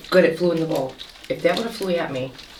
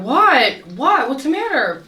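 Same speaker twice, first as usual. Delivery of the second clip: high, puzzled questioning; bewildered what what; confused surprise; 7 semitones higher, slower than usual. Retold or imitated. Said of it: "I looked up and I went".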